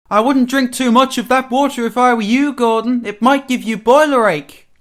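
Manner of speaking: high, loud engine voice with a warning; high-pitched cheeky advice; teasing engine Duck. A narrator's reading.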